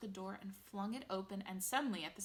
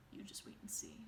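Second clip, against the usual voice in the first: soft teasing whisper; whispered secret; 8 dB quieter than usual. A narrator's reading. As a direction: keep the surprise hushed.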